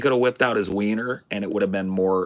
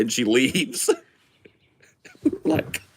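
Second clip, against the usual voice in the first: ordinary talk, nothing marked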